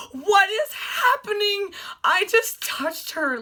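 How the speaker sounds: high, loud, drawn-out squeal; giddy disbelief. Retold or imitated. Fan retelling touching the star, giddy disbelief. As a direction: wail it slowly in overjoyed shock.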